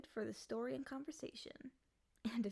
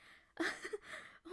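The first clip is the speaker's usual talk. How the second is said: high breathless giddy laugh; 10 semitones higher than usual. Acted. Freed demon laughing in disbelief at its freedom. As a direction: laugh with wild relief.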